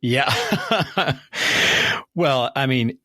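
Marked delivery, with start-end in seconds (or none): 0.4-2.1 high, airy chuckle; easy high laugh; amused laugh. Real, not acted. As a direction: laugh lightly, mostly on breath. Host laughs after fan-base remark.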